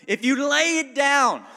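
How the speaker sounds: loud, high, driving pulpit cry; full-force sermon climax; fiery preaching. Acted. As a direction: push the point home to the crowd. Preaching peak.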